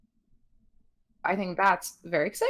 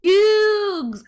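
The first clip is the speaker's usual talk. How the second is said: amused exclaim; 13 semitones higher, 6 dB louder than usual. Real, not acted. Reacting to a funny name.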